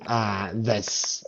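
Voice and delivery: ordinary delivery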